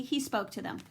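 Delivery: plain reading voice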